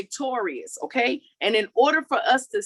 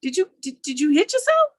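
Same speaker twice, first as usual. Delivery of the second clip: higher, surprised questions about the mark; startled friend; 6 semitones higher than usual. Retold or imitated. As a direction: play a friend suddenly spotting the birthmark. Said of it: "And then one day they're like".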